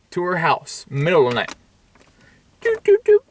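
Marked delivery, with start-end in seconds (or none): 2.6-3.2 three very high short toots; high imitated tooting. Kid arriving at night, high squeaky sound effect. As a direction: squeak out three quick notes.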